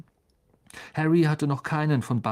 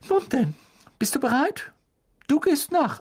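high, sing-song motherly voice; a kind mother ushering the guest first; fussing mother; 3 dB louder than usual. A narrator's reading. Mrs Weasley voiced by narrator.

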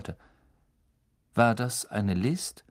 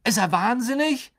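put-on higher, fast, incredulous boy's voice; snorted disbelief; 13 semitones higher, 4 dB louder than usual. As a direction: snort it out in shock. Ron aghast at Dumbledore.

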